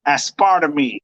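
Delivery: "Aspartame" ending in "tuh-mee"; wrong pronunciation. Shown as a demonstration. Host trying out the word, "Aspartame" said as-PAR-tuh-mee. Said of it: "For sure, I'm saying it wrong".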